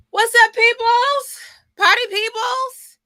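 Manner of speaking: big high drawn-out call to the crowd, slow; hype greeting. Real, not acted. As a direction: throw it out like a party host. Greeting the live audience.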